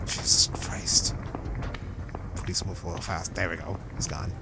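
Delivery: hushed near-whisper. Real, not acted. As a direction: whisper so nobody hears. Hushed while sneaking past.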